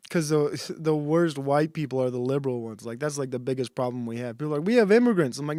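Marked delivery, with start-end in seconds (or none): 4.7-5.4 high, loud mock alarm; raised-voice imitation of a worried crowd; mock complaint. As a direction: imitate people complaining, voice pitched up. Acted quote of complaining people.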